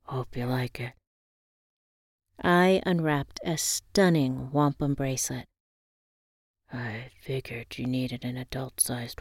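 lightly voiced old fisherman; gruff old fisherman. A narrator's reading. Granddad gives gift.

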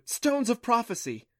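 high, loud burst of discovery; gleaming excitement. Acted. Clancy interrupts Adam with her discovery, high and excited. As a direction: cut in with an excited announcement. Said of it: "eyes gleaming".

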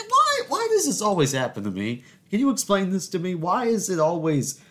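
high-pitched, pleading "why me" outburst; exasperated lament, voice way up high; comic exasperation. Retold or imitated. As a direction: whine to the heavens in mock despair. Retold exasperated complaint.

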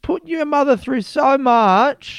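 high, drawn-out distraught mother; mock-wailing mum laying on the guilt; older-sounding mum impression. Mum scolding her son over the awful night, high hammed-up anguish. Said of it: "with that impression".

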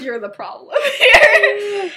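loud, high, slow, laughing through it; giggling mock blame; laughing accusation. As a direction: blurt the verdict while cracking up. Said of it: "They're like".

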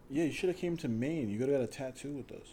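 ordinary talk, nothing marked

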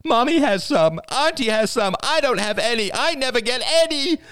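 high, loud, tearful wail; a toddler bawling over dessert; crying toddler. A narrator's reading. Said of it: "Ben burst into tears".

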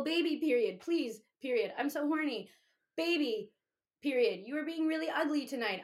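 needy texts declaimed word by word; mock pleading. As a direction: read out punctuation, mock-desperate. Reading own pleading texts.

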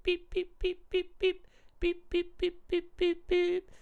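high sung beeps like toy piano notes. Retold or imitated. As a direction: chirp each beep up high like a wee piano. Imitating piano beeps in song.

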